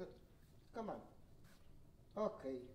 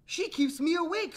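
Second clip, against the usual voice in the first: put-on high-pitched tattling child; complaining brother character; 9 semitones higher, 4 dB louder than usual. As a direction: accuse your sister in a child's voice. Story brother accuses sister.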